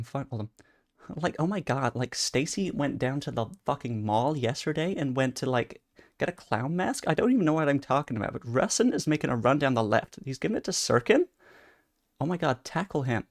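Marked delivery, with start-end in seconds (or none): 1.2-7.0 put-on a light California Valley girl voice; Valley girl accent. Showing chat his Valley girl voice, lightly done. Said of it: "a California Valley girl". 8.3-13.2 put-on light California Valley girl voice calling the play; Valley girl commentary. Calling his match in a lightly done Valley girl accent.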